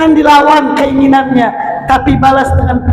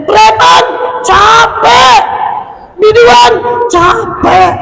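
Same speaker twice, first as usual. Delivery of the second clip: rising preaching shout; 8 semitones higher, slower than usual. Real, not acted. Preacher to the congregation, building to a high shout.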